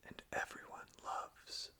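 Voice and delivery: slow, hushed lyric reading; slow whispered reading. Acted. Beginning the Ascending Forth lyrics for listeners, slow and whispered.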